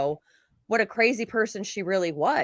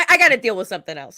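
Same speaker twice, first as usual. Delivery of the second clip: put-on thick Chicago accent, fast; 6 dB louder, much faster than usual. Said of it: "You sounded so Chicago".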